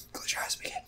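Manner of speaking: hushed whisper of the prompt; soft unvoiced meditation cue. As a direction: breathe the words out without voice. Reading meditation prompt aloud.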